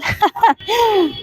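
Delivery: high, scornful acted laugh; mocking laugh. A narrator's reading. Knight scorning the caravan chief, mocking laugh. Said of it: "الفارس ضحك بسخرية".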